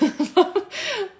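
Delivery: a loud, high burst of laughter. Real, not acted. Streamer joking about her bike: loud high laugh. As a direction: crack up at your own joke.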